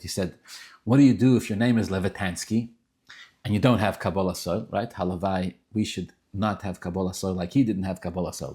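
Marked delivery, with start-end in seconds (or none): none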